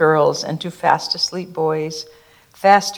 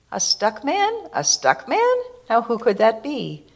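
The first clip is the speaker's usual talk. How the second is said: high puzzled voice; 4 semitones higher than usual. Acted. Stick Man wondering at voice.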